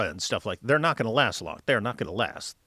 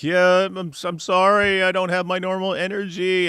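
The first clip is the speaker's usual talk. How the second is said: mock whining; 6 semitones higher, 4 dB louder than usual. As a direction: whine out a feeble excuse, hammed up. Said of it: "he started off by whining".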